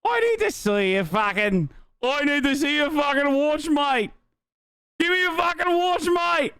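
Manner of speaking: high-pitched, hammed-up Aussie demand; exaggerated Aussie "mate" voice, high; attempted Australian accent. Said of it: "how to do an Aussie accent".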